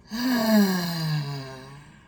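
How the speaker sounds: big loud vocalized sigh; loud voiced sigh. Shown as a demonstration. Therapist showing viewers a releasing breath, loud voiced sigh. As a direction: release a long sound on the breath. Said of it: "with a sigh, with some kind of vocalization".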